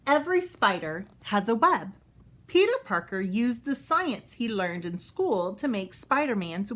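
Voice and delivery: bright, clear storybook read-aloud; reading to children. Acted. Teacher reading a Spider-Man book to kids, storybook voice. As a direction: read it out for young listeners.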